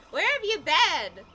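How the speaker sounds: high, loud question; crying out. A narrator's reading. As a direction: call it out to long-missed parents. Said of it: "I cried".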